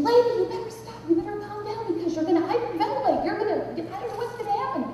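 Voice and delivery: high, urgent imitation; high-pitched nagging plea; agitated seatmate. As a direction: mimic a flustered man pleading. Panicked seatmate urging her to calm down.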